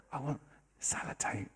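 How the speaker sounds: soft whispered request; little girl's whisper. Retold or imitated. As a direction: whisper the wish, barely voiced. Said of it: "she whispers in my ear".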